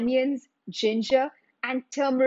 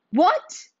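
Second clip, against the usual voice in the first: sharp angry "What?" jumping much higher; 10 semitones higher, 4 dB louder than usual. A narrator's reading. Nawab hears there is no dal ready, angry. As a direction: snap it out, offended. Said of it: "the Nawab said angrily".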